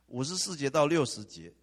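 五十四节到六十节 called out loud, stress on 到; emphatic reference. Shown as a demonstration. Preacher tells the congregation to look up the verses, loud call. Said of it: "我就要让你们请打出来哈".

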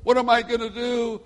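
high, self-pitying imitation; victim voice. Man at the pool lamenting his circumstances, mock helpless wail. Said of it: "he was a victim of his circumstances".

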